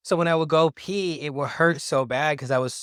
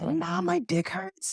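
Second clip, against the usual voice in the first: put-on high, slow little-boy whine; whiny little kid; much slower than usual. Little boy telling his mom he hurts, high whine. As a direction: whine it like a hurting small child.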